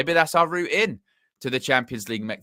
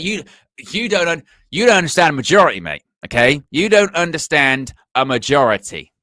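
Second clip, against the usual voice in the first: higher, louder, exasperated lecturing of a mate; hammed-up know-it-all hammering the point home; flexing KG impression; 4 semitones higher, 8 dB louder than usual. Football pundit mocking a colleague on air, loud and exasperated. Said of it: "do my best flexing K G impression".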